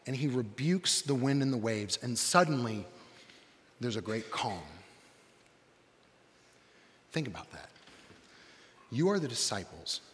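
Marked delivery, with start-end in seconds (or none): none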